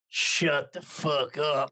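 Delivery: slow, drawn-out mock challenge; retold defiance. Five-five Edward facing the big guy, mock defiance. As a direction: imitate squaring up, stretching each word. Drunk small friend defies big stranger.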